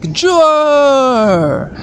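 high, drawn-out shout of a name; Goemon crying out. Acted. Goemon calls to Juo in a high, long cry. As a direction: yell after a friend in distress. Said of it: "Goemon is like".

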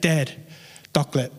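lightly imitated little boy, low and insistent; short repeated pleas in baby talk, pauses between; toddler son. Son pestering his sleeping dad, lightly imitated toddler. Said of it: "that's how he sounds".

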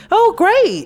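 sarcastic fake cheer. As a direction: toss it off with fake delight. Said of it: "you think I'm just supposed to be like".

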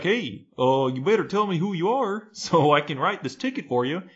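lilting, over-polite officer demanding identification; mock Canadian cop. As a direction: imitate a courteous cop writing a ticket. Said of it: "And he was like".

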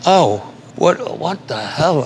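a stunned "what the hell"; shocked. Retold or imitated. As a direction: react like the news just hit you. Reacting to friend's news.

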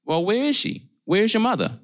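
sharp high demanding question; urgent demand. Acted. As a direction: press her hard for an answer.

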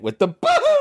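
high laughing boo-hoo; a playful imitation of a comic sob; mock boo-hoo. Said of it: "that was his boo-hoo".

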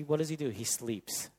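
ordinary delivery

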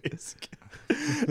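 laughing through the words; giggling it out high. Real, not acted. Laughing while repeating.

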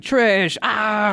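high mock anguished yell, drawn out; imitated shout. Host mimicking Bruno's cliffhanger cry, high imitated shout. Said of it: "Bruno just shouting".

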